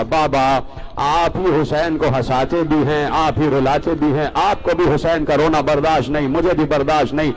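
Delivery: usual speaking voice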